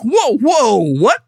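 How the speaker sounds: startled double take; mock astonishment; retold surprise. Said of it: "And I'm like".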